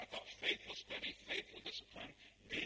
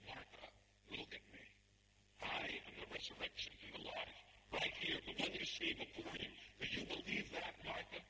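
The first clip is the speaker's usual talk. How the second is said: forceful, insistent declaration; Jesus. Acted. Jesus takes Martha by the shoulders, insistent and forceful. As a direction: build to an urgent, emphatic challenge. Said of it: "Jesus takes her by the shoulders".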